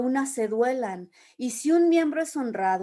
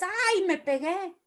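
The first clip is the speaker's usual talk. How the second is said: mock cry of pain; 6 semitones higher than usual. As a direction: imitate a yelp without really shouting. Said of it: "tu boca grita y dices". (grito de dolor fingido, agudo)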